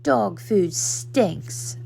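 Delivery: slowly, with mild disgust. A narrator's reading. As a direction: wrinkle your nose at the bowl. Puppy disgusted by dog food.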